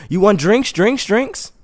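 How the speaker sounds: high-pitched hawker's patter; imitated drink seller; street touts. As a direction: pitch the offer high to passers-by. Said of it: "Walking past the people".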